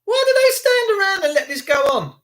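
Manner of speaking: high, indignant protest at the screen; outraged. Real, not acted. Streamer watching police, outraged and high. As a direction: cry out in disbelief at what you see.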